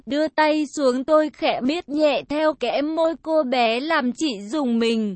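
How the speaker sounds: usual speaking voice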